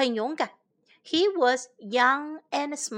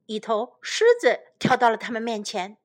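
usual speaking voice

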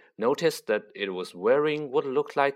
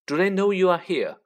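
higher, louder questioning; curious; 8 semitones higher, 5 dB louder, faster than usual. A narrator's reading. Boy questioning the elf, curious. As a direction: ask with open curiosity. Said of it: "asked Harry curiously".

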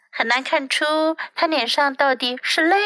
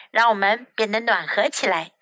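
ordinary delivery, nothing marked

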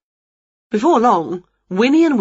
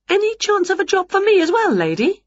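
high cheeky witch voice; 13 semitones higher than usual. Acted. Winnie asks for a job.

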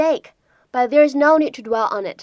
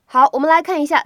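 ordinary talk, nothing marked

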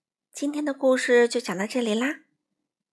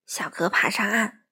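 plain reading voice

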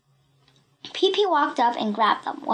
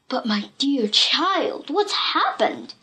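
slow, concerned father's question; anxious; slower than usual. A narrator's reading. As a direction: ask slowly with worry in a put-on father voice. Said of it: "said Captain Longstocking anxiously".

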